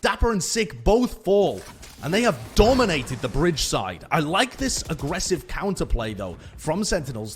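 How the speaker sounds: loud, high hype call; hyped caster. Real, not acted. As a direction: build up the excitement. Caster hyped by aggressive play.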